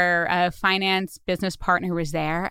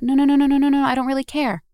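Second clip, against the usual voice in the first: emphatic retold no's; 6 semitones higher, 4 dB louder, faster than usual. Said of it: "They went".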